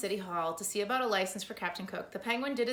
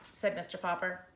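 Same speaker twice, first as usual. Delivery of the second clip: ordinary delivery, nothing marked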